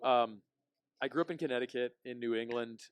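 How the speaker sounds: ordinary talk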